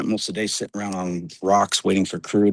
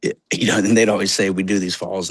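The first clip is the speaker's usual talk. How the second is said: usual speaking voice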